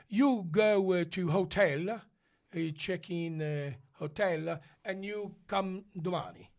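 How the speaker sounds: put-on halting broken English, Italian accent. Rehearsal staff send the tenor off to rest, Italian accent. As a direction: give slow instructions in an Italian accent.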